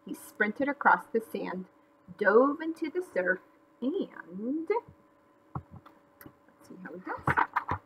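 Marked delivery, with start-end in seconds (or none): 3.8-7.8 hushed aside. A narrator's reading. Aside to listeners.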